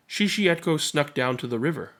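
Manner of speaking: plain reading voice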